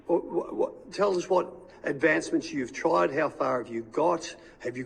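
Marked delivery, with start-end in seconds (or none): none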